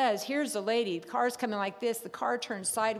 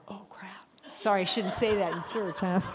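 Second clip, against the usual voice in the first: hushed, breathy confession to the congregation; sheepish whispered "oh, crap" with a giggle; whispered aside. Retold or imitated. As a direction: whisper it like a naughty secret in church. Inner panic, then church aside.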